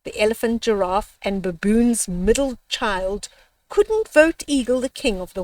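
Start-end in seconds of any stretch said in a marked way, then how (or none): none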